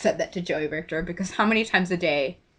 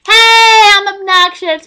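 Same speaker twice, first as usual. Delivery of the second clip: very high, loud mock call; obnoxious accosting; 11 semitones higher, 11 dB louder, slower than usual. Fan accosting every Star Kid, obnoxiously loud. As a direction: holler a drawn-out greeting, parodying yourself. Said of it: "I'm just accosting every single Star Kid".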